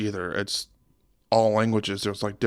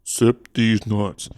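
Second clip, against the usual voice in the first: slow tease; 3 dB louder, much slower than usual. Acted. Teasing friend over drink.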